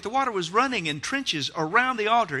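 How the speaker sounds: ordinary talk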